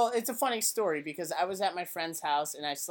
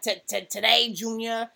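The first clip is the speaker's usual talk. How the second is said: Billy Madison quote; 5 semitones higher, 3 dB louder than usual. Retold or imitated. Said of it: "stuff like that".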